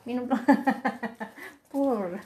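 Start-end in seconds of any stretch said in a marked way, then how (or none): 0.4-1.5 light chuckling laugh. Real, not acted. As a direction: laugh in a short rolling burst. Laughing at the pets' fight. (tawa kecil terkekeh)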